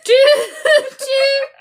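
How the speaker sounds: high, loud toot with laughter; playful train whistle. Pretend. Imitating a train for hop aboard.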